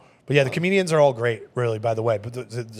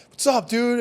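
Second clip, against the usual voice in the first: high, bright bro greeting; friendly greeting; 11 semitones higher, slower than usual. Retold or imitated. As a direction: greet him like an old buddy. Voicing Matt Rife greeting.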